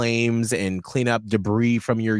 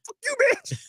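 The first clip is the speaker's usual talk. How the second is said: very high, mock-angry squeal; hammed-up outraged insult in a high voice; Holly; faster than usual. Co-host joking how a colleague would read the comment, mock outrage. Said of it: "Holly would have read it as".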